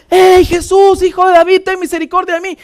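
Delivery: high, loud plea acted as a blind beggar; imitated crying out. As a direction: call out to Jesus over a crowd. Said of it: "acá gritando". (grito de súplica imitado)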